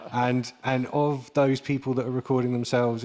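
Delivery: ordinary delivery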